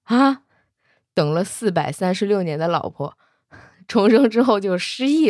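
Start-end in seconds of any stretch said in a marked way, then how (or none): 0.0-0.4 startled, higher and louder "huh?"; bewildered. Acted. Dragon prince doubting his ears, bewildered. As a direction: blurt it out, totally lost. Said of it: "神色茫然".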